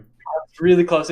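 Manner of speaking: plain reading voice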